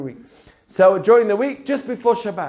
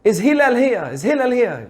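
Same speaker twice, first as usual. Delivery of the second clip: imitated screaming; 6 semitones higher than usual. Man hollering for Hillel outside his house, mock screaming. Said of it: "starts screaming at the top of his lungs".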